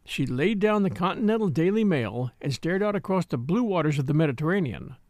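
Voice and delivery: ordinary delivery, nothing marked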